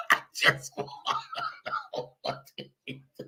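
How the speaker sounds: words squeezed out through laughter; breathless laughing. Real, not acted. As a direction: barely get the words out for laughing.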